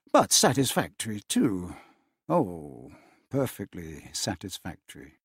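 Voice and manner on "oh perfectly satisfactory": usual speaking voice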